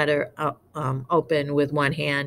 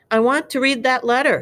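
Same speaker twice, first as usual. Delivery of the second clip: raised, high-pitched demand; loud demand; 7 semitones higher, 4 dB louder than usual. A narrator's reading. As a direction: insist with a raised voice. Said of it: "he said loudly".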